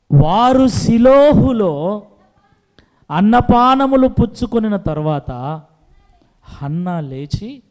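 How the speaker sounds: slow, loud, drawn-out scripture reading; preaching scripture. Acted. Preacher reads the verse to his congregation, slow and loud.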